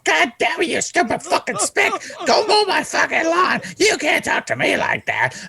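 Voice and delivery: retold coworker screaming himself hoarse over the nickname; imitated furious rant. Insulted coworker shrieking at the narrator, furious and near tears. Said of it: "he's legitimately pissed".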